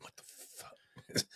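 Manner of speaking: breathless whisper of shock, slowed; a stunned whispered curse; whispered disbelief. Retold or imitated. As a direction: hush it out as if stunned at yourself. Disbelief after crying breakdown.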